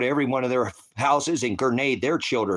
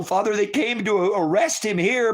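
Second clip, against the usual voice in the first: usual speaking voice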